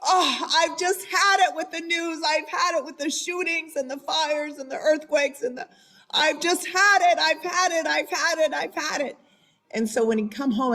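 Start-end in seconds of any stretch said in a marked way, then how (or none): 0.0-9.2 exasperated husband. Retold or imitated. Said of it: "he would come home at night like".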